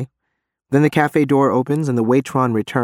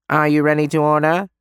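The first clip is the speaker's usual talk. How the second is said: thin, nasal, higher waiter voice; 4 semitones higher than usual. Acted. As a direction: pinch it through the nose. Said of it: "he asks in a high nasal voice".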